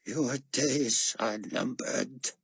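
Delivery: slow menacing threat; growled. Acted. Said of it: "Nikolai growled".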